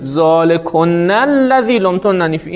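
ذالکن الذی لمتننی فیه recited slowly, doubled consonants held; slow Quranic recitation of ذالکن الذی; quoting the Quran. Shown as a demonstration. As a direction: recite ذالکن with a long held n, measured pace. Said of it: "verse repeated".

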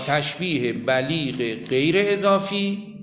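slow dictation with long pauses. Real, not acted. Teacher telling students what to write under the line, slow dictation. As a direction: dictate word by word, drawing each one out. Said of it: "بنویسید".

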